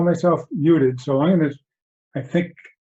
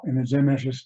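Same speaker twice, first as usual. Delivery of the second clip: ordinary talk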